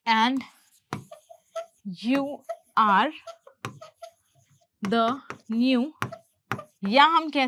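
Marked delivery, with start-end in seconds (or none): 0.1-5.9 slow dictation, each word set apart. Shown as a demonstration. Teacher building the translated sentence, word by word. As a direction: leave long gaps between the words.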